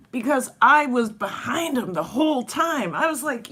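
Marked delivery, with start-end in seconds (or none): none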